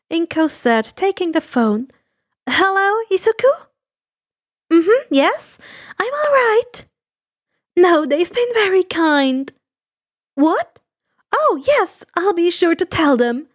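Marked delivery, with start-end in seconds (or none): 2.5-13.5 gentle mother. Acted. Inko on phone with son.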